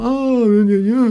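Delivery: high-pitched stalling "gna gna"; floundering gibberish. Acted. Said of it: "vous répétez la phrase d'avant en faisant". (bafouillage aigu du trou de mémoire)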